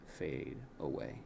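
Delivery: plain reading voice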